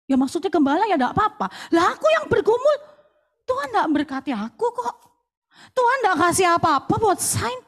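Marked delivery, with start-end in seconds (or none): 1.7-7.5 complaining. Retold or imitated. Retelling her complaint to God.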